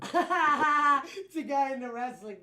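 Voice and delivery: talking through a big laugh. Real, not acted. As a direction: laugh loudly while speaking. Laughing while explaining.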